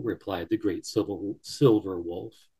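plain reading voice

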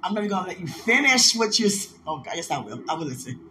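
ordinary talk, nothing marked

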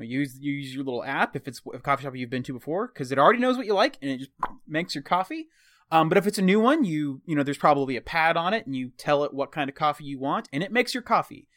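ordinary talk

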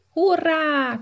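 very high, loud hurrah; excited cheer. Acted. A few monkeys cheering the King Kong contest, very high. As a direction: burst out in delight. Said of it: "kiáltották páran".

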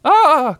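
high, loud squeal; sudden high-pitched cry; cried out. A narrator's reading. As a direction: yelp in a tiny creature's voice. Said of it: "cried Piglet".

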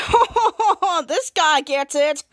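booming ho-ho then drawn-out words; hero's mock laugh. Hero approving his helper, put-on laughing voice. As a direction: laugh showily into slow approval.

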